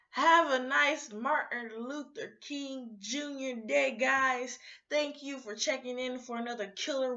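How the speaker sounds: sign-off call. Real, not acted. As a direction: call it out to all the viewers. Signing off loudly to viewers.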